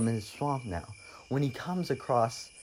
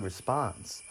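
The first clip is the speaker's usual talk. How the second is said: ordinary delivery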